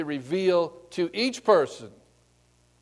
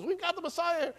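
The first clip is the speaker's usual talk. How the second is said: excited; 12 semitones higher, 7 dB quieter than usual. Retold or imitated. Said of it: "runs to town, and says".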